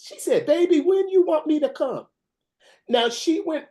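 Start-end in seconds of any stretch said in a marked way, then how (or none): 0.5-2.1 high, eager grandmother voice; change to an eager, loving granny; sweet grandma. Retold or imitated. Grandmother now eager asks when to come, high.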